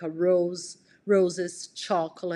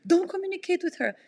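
higher, fast imitated yelling; mock boyfriend yelling; 10 semitones higher, faster than usual. Boyfriend ordering her on the phone, higher mock yelling. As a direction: mimic his frantic orders. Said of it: "and he's like".

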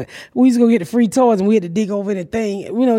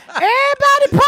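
high, far-off cry of disbelief; high cry of betrayed surprise; shocked outburst; 14 semitones higher than usual. Retold or imitated. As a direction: squeal it in dismay. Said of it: "I said".

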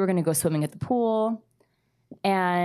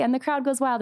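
lightly raised, higher announcer call; playful announcer; 7 semitones higher than usual. Retold or imitated. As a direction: echo the crowd line like a fond commentator. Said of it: "And I was narrating".

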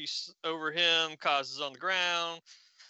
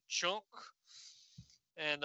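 usual speaking voice

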